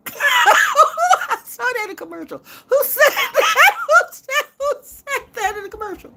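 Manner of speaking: high squealing laughter through the words; laughing hard. Real, not acted. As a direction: crack up while asking.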